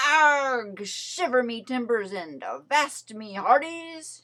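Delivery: loud pirate growl; loud pirate roar. A narrator's reading. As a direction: bellow like a pirate captain. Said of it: "she roared".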